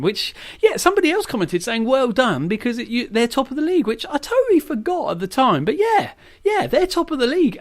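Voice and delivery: high, delighted and bubbling; excited. Real, not acted. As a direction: gush over the surprise result. Thrilled by a draw with league leaders.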